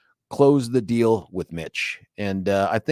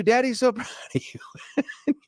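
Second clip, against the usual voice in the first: laughing; 13 semitones higher, slower than usual. Retold or imitated. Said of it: "And I'm like".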